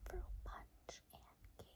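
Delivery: soft breathy whisper, slow. Shown as a demonstration. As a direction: whisper it slowly near the ear. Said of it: "and then I'm gonna go ahead and whisper".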